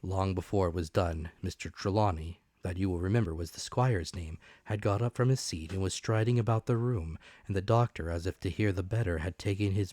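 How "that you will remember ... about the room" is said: plain reading voice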